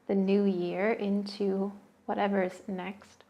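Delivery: soothing guided meditation voice. Acted. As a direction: settle the listener in, unhurried. Leads a seated meditation.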